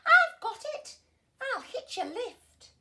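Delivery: very high, loud eureka shout; excited cry. A narrator's reading. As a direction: burst out with a bright idea. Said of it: "then cried".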